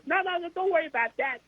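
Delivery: very high, chatty boxer voice; Mike Tyson. Retold or imitated. Boxer chatting to reporter as if in a hallway, high casual impression. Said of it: "he's talking to me like we're just in the hallway".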